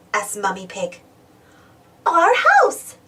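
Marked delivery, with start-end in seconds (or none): 2.0-3.0 put-on high, louder child exclaim; a glad little cry; excited cry. Peppa naming her house to Mummy, excited cry. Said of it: "cries Peppa".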